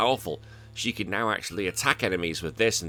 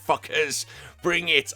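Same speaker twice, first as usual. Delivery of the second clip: cocky taunt; 7 semitones higher, slower than usual. Retold or imitated. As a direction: dare them to come at you. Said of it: "I'm like".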